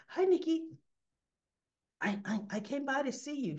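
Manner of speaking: high-pitched, stammering mock innocence; flustered greeting. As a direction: play caught out and covering up. Caught, makes up an excuse.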